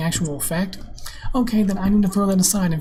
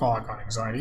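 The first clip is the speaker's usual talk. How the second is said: lower, softer dopey mock of a whiner; 7 semitones lower, 6 dB quieter, faster than usual. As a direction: drop it down and sneer the excuse. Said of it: "the fuckers are like".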